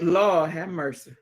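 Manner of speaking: ordinary talk, nothing marked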